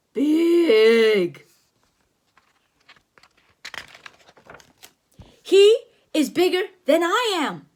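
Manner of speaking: booming storybook whale; big whale voice. Acted. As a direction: play the big whale bragging about his size. Reading the big whale's lines.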